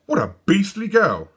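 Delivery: put-on high indignant boys; indignant twins. A narrator's reading. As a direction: condemn her.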